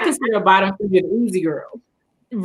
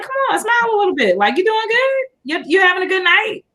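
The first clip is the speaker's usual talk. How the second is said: imitating the worker, much higher and fast; a bright, quick encouraging call, pitched up; higher, faster cheerful mock worker; high friendly imitation; 11 semitones higher than usual. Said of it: "he was like".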